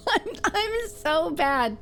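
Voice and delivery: high, slow words dissolving into laughter; helpless giggling. Real, not acted. Streamer failing the requested Stitch impression, giggling helplessly. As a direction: break up laughing mid-sentence.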